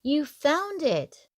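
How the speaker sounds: excited call. A narrator's reading. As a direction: call it out, delighted she made it. Calling out, glad she arrived.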